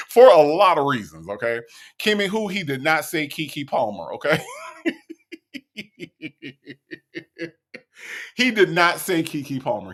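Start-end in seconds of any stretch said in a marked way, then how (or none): 4.5-7.5 long, soft, breathless laugh; wheezing laughter. Real, not acted. Laughs at viewer's guess.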